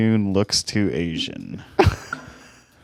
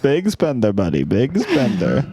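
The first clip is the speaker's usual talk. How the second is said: put-on Iranian accent; 5 semitones higher than usual. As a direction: ham up a Persian accent. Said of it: "I practice my Iranian".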